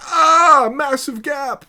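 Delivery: very high, loud mock scream of alarm; mock panic. Imitating opponents who spot the gap, high panicked yell. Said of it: "They're gonna be like".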